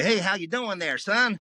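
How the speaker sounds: high-pitched Midwestern phone greeting; Midwest caller. Retold or imitated. Midwest caller greeting the voiceover artist, imitated Midwest accent. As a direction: call out chummily in a put-on Midwest accent. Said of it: "from the Midwest and they go".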